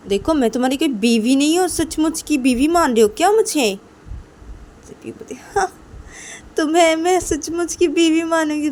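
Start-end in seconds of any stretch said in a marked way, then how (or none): 0.1-3.7 loud, high indignant challenge; affronted, heated protest; angry objection. Acted. Ruhi objects to being treated as wife. 5.3-8.7 high, loud indignant comeback; affronted disbelief; incredulous retort. Acted. Partner scoffs back in acted quarrel.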